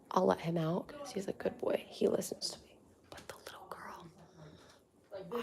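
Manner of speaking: soft unvoiced whisper. Real, not acted. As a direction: keep it hushed so nobody hears. Whispering so dogs don't hear.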